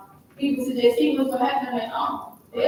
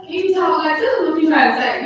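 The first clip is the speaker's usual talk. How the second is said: higher, louder exasperated questioning; puzzled disciple pressing the teacher; confused disciple; 5 semitones higher, 7 dB louder than usual. Retold or imitated. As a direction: demand an answer like a baffled follower. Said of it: "one of the disciples was like".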